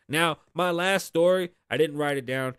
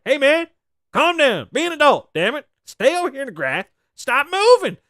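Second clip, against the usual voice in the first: much higher, louder barking orders; mock bossy cop; 11 semitones higher, 8 dB louder than usual. Imitating the cop ordering [name] to calm down. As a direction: bark orders like a fed-up cop.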